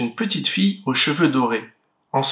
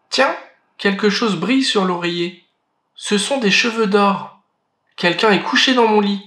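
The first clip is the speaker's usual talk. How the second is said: higher, quick little cub; a small bear's excited discovery; the little bear finding the golden hair in his bed, higher and faster; 7 semitones higher than usual. Acted. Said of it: "de sa petite voix".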